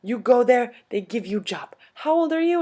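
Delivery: loud, high, insistent old-lady imitation; old European lady. Old lady urging them into acting, loud and high. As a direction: push them on like a little foreign old lady. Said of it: "She's like".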